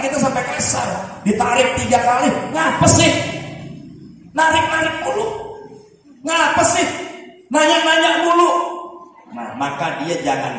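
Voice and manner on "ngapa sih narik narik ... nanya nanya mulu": put-on higher, annoyed mother snapping at her child; irritated mother. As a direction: play the impatient mom brushing off the child.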